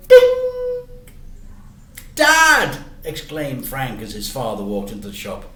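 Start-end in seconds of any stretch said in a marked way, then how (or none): 0.1-1.0 very high loud bell sound; bright imitated bell; shop bell ding. 2.2-2.8 high, loud surprised cry; boy surprised to see his dad; surprised call. Acted. Boy sees father arrive.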